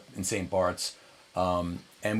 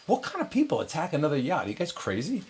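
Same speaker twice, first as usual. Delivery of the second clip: higher-pitched imitation of disbelief; incredulous demand; 7 semitones higher than usual. Rivals confront crew about yacht attack, incredulous. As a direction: mimic baffled, indignant rivals.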